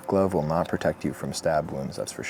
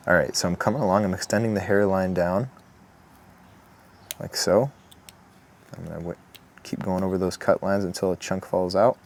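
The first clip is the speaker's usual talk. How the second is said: ordinary talk, nothing marked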